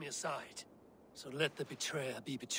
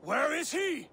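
much higher, louder furious question; angry demand; 13 semitones higher, 6 dB louder than usual. Acted. Eivor angrily demands where Alfred is. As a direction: demand it, losing patience.

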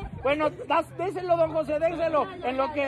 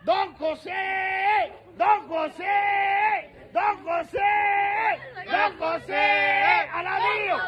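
slow stretched call, higher; calling don José out of his house; drawn-out calling; 3 semitones higher, much slower than usual. Real, not acted. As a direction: stretch the name out like calling across a block. Long calls to the neighbor.

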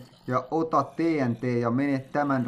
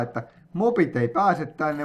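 ordinary delivery

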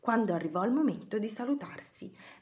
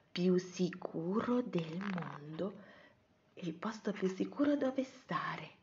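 slow, fading into a soft whisper; hushed wonder; 2 semitones lower, 10 dB quieter, slower than usual. A narrator's reading. Narrator describes the safe, glittering cave, hushed wonder. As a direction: drop to an awed whisper.

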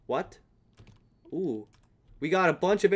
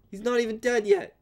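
voice crack; 7 semitones higher than usual. Real, not acted. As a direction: voice cracking up high. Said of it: "Voice crack again".

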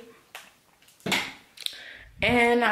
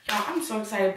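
ordinary talk, nothing marked

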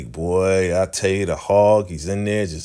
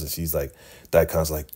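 ordinary talk

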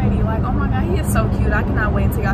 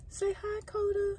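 high puppy talk; 10 semitones higher, 14 dB quieter than usual. Real, not acted. Prompting puppy to greet viewers.

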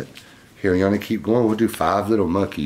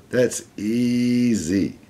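put-on very slow, elongated childlike brag; drawn-out boast; 4 semitones higher, much slower than usual. A narrator's reading. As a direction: stretch it out slowly, showing off. Boastful monkey in story, drawn out.

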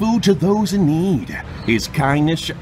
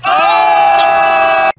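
long loud cry; 13 semitones higher, 8 dB louder than usual. Acted.

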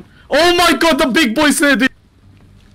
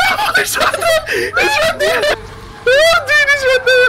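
high panicked laughing scream; panicking gamer. Real, not acted. Chased by monster in game.

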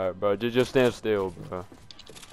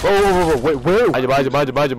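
loud panicked warning; urgent shouting; 6 dB louder, much faster than usual. Real, not acted. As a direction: yell the warning fast. Panicked warning during gunfight.

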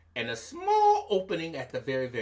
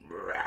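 tiger roar; 10 semitones lower, 8 dB quieter than usual. Acted.

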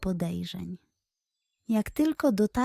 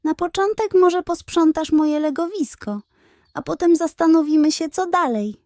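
higher, louder and quicker, teasing; playful; 7 semitones higher, 5 dB louder than usual. A narrator's reading. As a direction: hand out the chore with a cheeky glint. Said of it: "oczy błysnęły figlarnie".

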